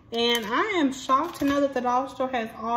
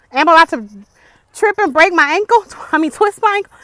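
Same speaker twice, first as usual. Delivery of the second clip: alarmed; 6 semitones higher, 12 dB louder, faster than usual. Real, not acted. Alarmed in the woods.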